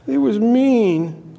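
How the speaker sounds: high, slow, exaggerated scorn; mean. Preacher voicing how harsh the lord sounded. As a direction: stretch it out as if the words sting. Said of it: "I just hear that".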